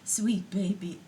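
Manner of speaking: a parent cooing tenderly to an infant; parent cooing to baby. Retold or imitated. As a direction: coo it like a doting parent. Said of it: "and they're saying".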